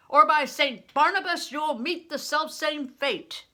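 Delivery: loud threatening roar; furious booming threat; bellow. A narrator's reading. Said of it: "he bellowed".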